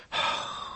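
weary exasperated sigh; breathy huff of annoyance. Acted. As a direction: let out a fed-up sigh. Exasperated brother is called again.

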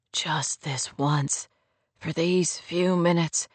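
low, soft, slowed plea; pleading male lead. A narrator's reading. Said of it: "he pleaded".

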